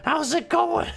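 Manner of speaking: high, raspy cartoon mom, slow greeting; Marge Simpson impression. Gamer doing a high Marge impression greeting Homer. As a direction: croon it up high like a cartoon housewife. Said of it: "I sound like Marge Simpson".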